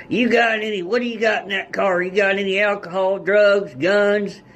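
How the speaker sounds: voicing cop. Voicing the cops' questions.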